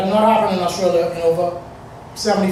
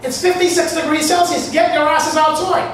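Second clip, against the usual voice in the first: panicked workers shouting through the door; retold shouting; 9 semitones higher than usual. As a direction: bark warnings at them to get out now. Workers yelling them out of caravan.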